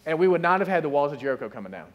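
ordinary talk, nothing marked

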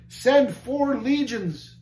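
high, slow call for reinforcements; cry for help. A narrator's reading. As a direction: call out desperately for troops. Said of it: "cried another".

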